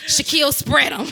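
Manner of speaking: mock dominatrix command. Ordering her boyfriend Shaquille around, loud mock command. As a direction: bark it like a playful dominatrix.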